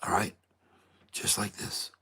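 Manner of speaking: hushed golf announcer voice; golf commentator. Shown as a demonstration. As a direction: whisper softly like a golf broadcast. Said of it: "talking with my golf voice".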